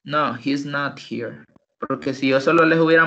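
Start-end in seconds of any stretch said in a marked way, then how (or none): 0.0-1.4 slow "he's not here", stress on "not"; slow other form. Shown as a demonstration. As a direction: model the other form slowly. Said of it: "Y la otra forma". (otra forma, lenta)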